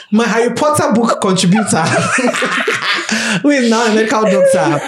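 real laughter breaking through the words; laughing. Real, not acted. Host laughing while introducing old friend.

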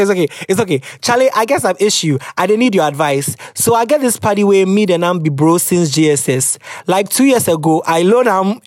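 ordinary talk

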